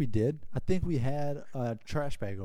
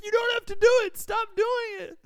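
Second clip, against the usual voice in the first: much higher, squealing plea; desperate begging; 5 dB louder than usual. Retold or imitated. Scared passenger begging his friend on the jet ski, high squealing plea. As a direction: beg in full panic.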